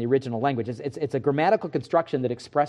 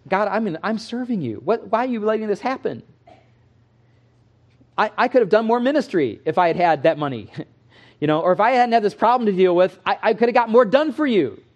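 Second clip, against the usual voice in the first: whiny pleading prayer, pitched higher; complaining believer; 7 semitones higher, 4 dB louder than usual. Retold or imitated. Believer complaining to God, voiced by the preacher, higher and louder. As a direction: plead with God like a frustrated servant.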